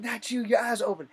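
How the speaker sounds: loud, high mock bark; drill sergeant yell. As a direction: snap it at the recruit like a drill instructor. Drill sergeant barking at recruit.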